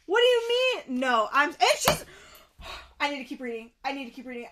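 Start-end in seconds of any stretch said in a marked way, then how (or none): 0.1-2.0 high, loud indignant sputtering; outraged disbelief. Real, not acted. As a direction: demand answers, breaking off in outrage. Outraged at the best friend.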